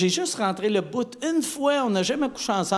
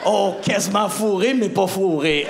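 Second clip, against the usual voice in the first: ordinary talk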